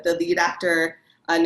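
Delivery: ordinary talk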